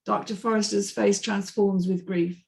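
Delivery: ordinary delivery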